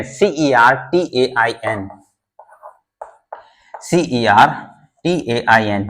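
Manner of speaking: slow spelling. Shown as a demonstration. Teacher spelling a word for students, slow and drawn out. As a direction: spell सीईआरटीएआईएन letter by letter, very slowly. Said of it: "मैं देखो spelling बोल भी रहा हूँ".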